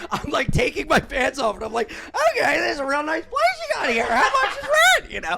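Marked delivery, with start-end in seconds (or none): 2.3-5.1 high, laughing small talk; mock nervous chatter. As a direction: squeal it as retold awkward politeness. Retelling his nervous small talk.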